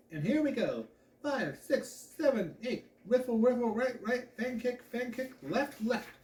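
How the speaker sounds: high, rhythmic dance-step calls; French dance teacher's emphatic count; Madame Lamont counting in. A narrator's reading. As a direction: drive the dancers through each move.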